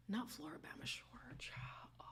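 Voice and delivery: unvoiced whispered aside; breathy whispered gossip. Real, not acted. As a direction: whisper it like a secret.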